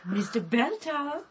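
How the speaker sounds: softly testing if anyone is there; quiet, cautious questioning call; tentative call. Acted. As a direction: call out gently, rising at the end.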